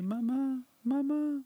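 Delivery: put-on high, drawn-out child's call; crying out for mother. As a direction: mimic a little girl calling for mom. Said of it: "elle continue à crier".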